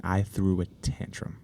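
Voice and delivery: low, hushed confession with pauses; solemn storytelling. Pretend. Player confessing childhood Clue disaster, solemn storytelling. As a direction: draw out the tale gravely and softly.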